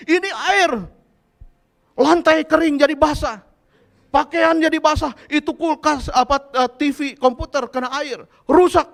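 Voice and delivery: high, loud ranting tirade; angry scolding. Retold or imitated. Preacher as relative scolding over the soaked house, angry nagging. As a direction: rant item by item, building to a yell. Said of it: "Dia datang ngomel, marah-marah".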